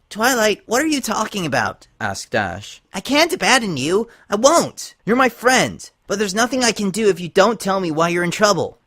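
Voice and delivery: high, loud, earnest plea; high, loud, loyal pony pleading; insistent Rainbow Dash. A narrator's reading. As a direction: refuse to abandon her friend.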